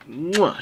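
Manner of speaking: high, smacked "mwah"; exaggerated kiss of praise; chef's kiss sound. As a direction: kiss the air in approval. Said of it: "chef's kiss".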